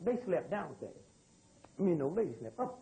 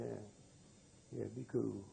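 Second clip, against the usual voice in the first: cool murmur; 9 semitones lower, 10 dB quieter, much slower than usual. Retold or imitated.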